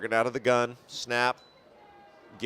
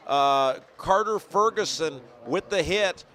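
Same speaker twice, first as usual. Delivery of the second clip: higher, lively live game call; play-by-play; 6 semitones higher than usual. Real, not acted.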